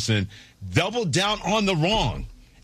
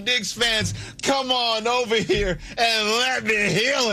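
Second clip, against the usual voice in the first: hammed-up revival preacher, higher pitched; mock faith healer; 7 semitones higher than usual. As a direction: lay hands on the hurting fans like a tent revival healer. Host casts rival coach as healer.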